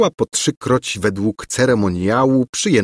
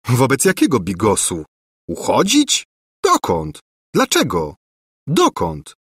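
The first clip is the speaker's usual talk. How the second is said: short, puzzled questions jumping in pitch; baffled questions; 3 semitones higher than usual. A narrator's reading. Prince told to flee, baffled. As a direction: fire off bewildered questions one by one.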